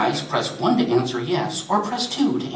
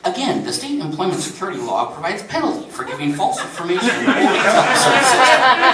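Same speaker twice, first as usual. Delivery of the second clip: phone system rebuke rising higher and much louder; recorded notice swelling into a loud reprimand; stern automated warning; 9 semitones higher, 4 dB louder than usual. Acted. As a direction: drive the legal warning home, building in volume. Phone system repeats warning pointedly.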